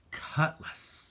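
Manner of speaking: breathy whisper of a remembered word. A narrator's reading. Boy recalling the sword's name, hushed whisper. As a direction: whisper it like a sudden realization.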